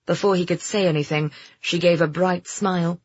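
ordinary delivery

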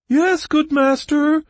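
very high, loud, slow creature whine; shrill, plaintive. A narrator's reading. Bog creature answering the guard, shrill and plaintive. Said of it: "a shrill and plaintive voice".

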